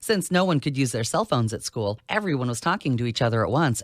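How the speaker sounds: usual speaking voice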